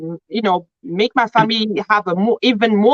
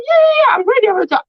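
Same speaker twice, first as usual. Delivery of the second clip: higher and louder, bursting with eager yeses; eager excitement; 11 semitones higher, 4 dB louder, much faster than usual. Retold or imitated. Husband eagerly telling his wife he is ready, excited. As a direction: jump at the chance, all keen.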